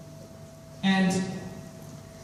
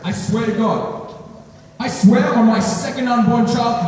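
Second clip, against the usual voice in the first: louder, higher, insistent swearing; acted frantic pleading; 5 semitones higher, 5 dB louder than usual. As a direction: plead desperately to be believed. Frantic phone plea to friend.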